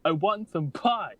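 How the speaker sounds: high, loud flustered cover-up; super awkward voice. Acted. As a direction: blurt it out nervously. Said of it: "super awkward talking voice".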